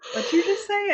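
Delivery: high, loud mock challenge; incredulous. Therapist challenging her cheeky client, incredulous. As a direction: imitate amused disbelief.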